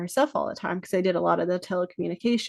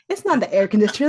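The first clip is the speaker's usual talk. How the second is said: insisting; 2 semitones higher, 6 dB louder, faster than usual. Retold or imitated. Insisting to husband.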